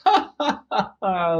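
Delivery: high mock laugh. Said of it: "Howard's mommy laughed".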